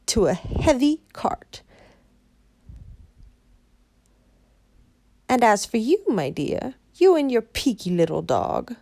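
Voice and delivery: sneering triumphant witch; wicked witch voice. Acted. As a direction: witch gloating over captives.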